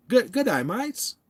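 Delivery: hammed-up Aussie greeting; amateur Aussie accent; Australian accent attempt. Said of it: "Do I sound like I'm from there?".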